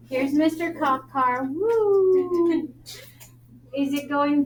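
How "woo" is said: high playful whoop; playful woo. Pretend. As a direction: cheer the cop car on. Cop car toy sound.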